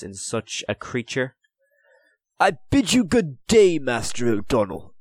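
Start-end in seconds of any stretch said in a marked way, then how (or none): 2.4-4.9 crisp, curt and higher voiced; a brisk, dismissive greeting. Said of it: "Perrault said briskly".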